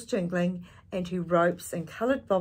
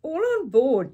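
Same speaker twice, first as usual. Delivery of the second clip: loud high call to all aboard; Dad shouting; 9 semitones higher, 9 dB louder than usual. A narrator's reading. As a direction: bellow it across the boat. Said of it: "Dad shouted".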